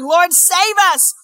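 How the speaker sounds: high, loud, drawn-out cry for help; hammed-up desperate plea to be saved; panicked shouting. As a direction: shout as if the boat is sinking. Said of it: "woke him up shouting".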